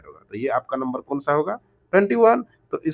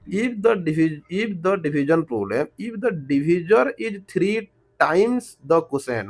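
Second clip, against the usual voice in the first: very slow, word by word reading of the question; much slower than usual. Real, not acted. Teacher reading the word problem aloud to students, very slowly. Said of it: "इतना धीरे-धीरे बोल रहा हूँ".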